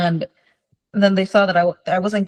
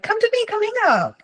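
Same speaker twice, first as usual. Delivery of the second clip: much higher, sing-song coaxing invite; mom's coaxing call. Retold or imitated. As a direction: lure the child over sweetly. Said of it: "mom's like".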